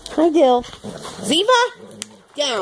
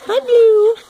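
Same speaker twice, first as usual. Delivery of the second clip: high pet voice; 7 semitones higher, 3 dB louder than usual. Real, not acted. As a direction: coo it up high like to a puppy. Greeting dog in pet voice.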